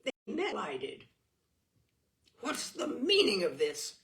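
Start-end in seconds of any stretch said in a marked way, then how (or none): 2.4-4.0 louder, a sharp angry challenge; furious demand. Acted. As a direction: confront your brother, furious. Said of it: "John demanded furiously".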